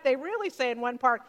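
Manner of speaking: normal talking voice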